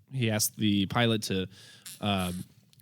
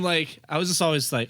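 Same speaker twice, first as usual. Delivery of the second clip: usual speaking voice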